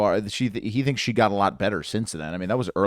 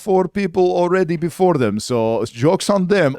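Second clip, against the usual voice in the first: put-on Borat accent; 8 semitones higher, 5 dB louder than usual. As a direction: keep the Borat voice going. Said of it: "That wasn't a Borat joke".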